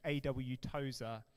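ordinary talk, nothing marked

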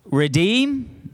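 REDEEM with a high rising second syllable; call-and-response. Real, not acted. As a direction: call out "Redeem" for the crowd to echo, lifting high on "deem". Leading congregation to repeat words.

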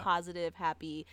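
ordinary delivery

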